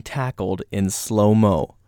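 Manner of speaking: drawn out like a slow-motion replay. Acted. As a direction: stretch every word out, very slow. Describing slow-motion video.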